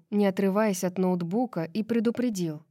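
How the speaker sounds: ordinary delivery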